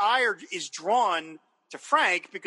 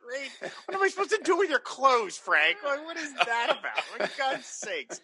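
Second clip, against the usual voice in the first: higher, incredulous mock scolding; exasperated Margaret; 6 semitones higher than usual. Margaret scolding Frank over his clothes, incredulous and higher. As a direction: imitate her throwing up her hands.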